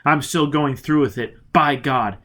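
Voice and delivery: resolute command. Acted. Foyle ordering Robin onward to Shanghai, loud and resolute. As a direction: steel himself and go.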